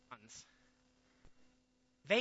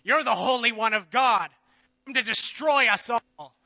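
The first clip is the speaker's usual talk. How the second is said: much louder demonic shout; possessed man shouting; 3 semitones higher, 10 dB louder than usual. Acted. Possessed man in the crowd shouting at Jesus, loud cry. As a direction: cry out against the Holy One. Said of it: "started shouting".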